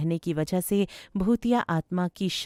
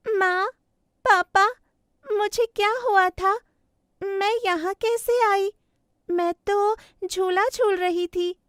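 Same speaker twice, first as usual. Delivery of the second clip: high-pitched little daughter voice; bewildered child bird; 13 semitones higher, 4 dB louder than usual. Acted. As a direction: come round confused, in a high child voice.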